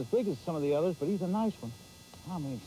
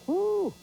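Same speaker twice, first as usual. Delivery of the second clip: much higher, louder joyful whoop; excited whoop; 6 dB louder than usual. Real, not acted. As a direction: let out a whoop of delight. Whoops on landing big bass.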